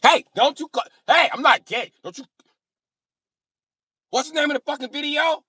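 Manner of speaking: high, loud defensive hollering. Retold or imitated.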